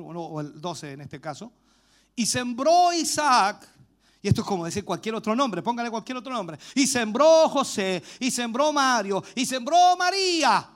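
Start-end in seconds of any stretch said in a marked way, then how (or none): 2.1-3.7 slow, loud scripture proclamation; lectura proclamada. Real, not acted. 6.7-10.7 slow, loud scripture proclamation; lectura proclamada. Real, not acted.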